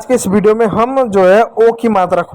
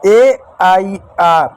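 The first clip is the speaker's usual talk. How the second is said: letter by letter, very slow; slow spelling; much slower than usual. Shown as a demonstration. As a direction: spell each letter apart. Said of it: "दो मात्राएं लग सकती हैं". (धीरे धीरे अक्षर बोलना)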